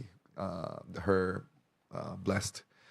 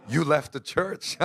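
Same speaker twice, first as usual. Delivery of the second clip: higher, drawn-out accusation breaking into laughter; laughing retort; 6 semitones higher, 4 dB louder, slower than usual. Retold or imitated. Child reminding mother she left, laughing retort. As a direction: throw it back, slow and amused.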